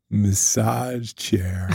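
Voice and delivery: slow, soft, low and drawn out; creepy ad voice. Acted. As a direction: let the ad in the back seat breathe it out slowly. Ad voice lurking in back seat.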